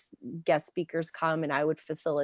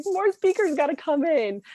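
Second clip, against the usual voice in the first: mock excited demand; 11 semitones higher, 5 dB louder than usual. Retelling her excitement on stage.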